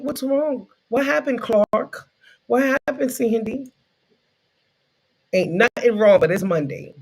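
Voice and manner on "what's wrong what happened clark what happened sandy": higher-pitched mock concern; concerned coworkers. Coworkers asking what's wrong on Monday, mock-concerned. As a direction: imitate solicitous colleagues in a lifted voice.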